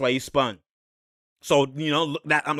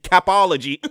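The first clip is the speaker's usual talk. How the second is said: usual speaking voice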